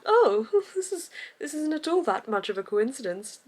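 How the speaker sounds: lampoon the character's fake surprise; mocking the character. Vlogger mocking a book character's reveal, hammed-up. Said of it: "the character's just like".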